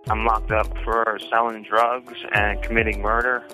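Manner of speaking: ordinary talk, nothing marked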